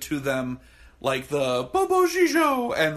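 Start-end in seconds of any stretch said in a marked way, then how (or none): 1.7-2.8 high, loud drawn-out catchphrase; hammed-up film quote, pitched way up; mimicked catchphrase. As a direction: stretch it out in a raised cartoonish voice.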